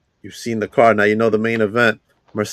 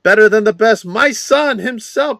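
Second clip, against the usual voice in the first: hype promo read; 8 semitones higher, 4 dB louder than usual. Acted. Hyped event promo read.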